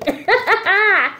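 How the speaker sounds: amused giggle; high burst of laughter. Real, not acted. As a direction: laugh at your own sass. Laughs at her own cockiness.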